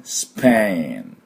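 スペイン slow, ending on ン with lips open; slow スペイン, the final ン never closing the mouth. Shown as a demonstration. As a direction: let the ン of スペイン ring with lips apart. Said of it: "唇開いてる感じ".

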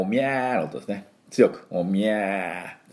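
"おみゃー" drawn out slowly and firmly; strong ma sound. Shown as a demonstration. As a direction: stretch the "おみゃー" and lean into it. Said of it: "マをおみゃーの音ですね".

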